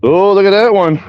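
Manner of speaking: high, bright burst of delight; pleased cry at a good throw; excited. Real, not acted. Frisbee throw lands well.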